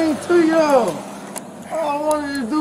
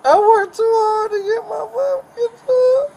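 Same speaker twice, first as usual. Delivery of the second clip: crying; 8 semitones higher than usual. Real, not acted. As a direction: voice breaking into sobs. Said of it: "Why are you crying now?".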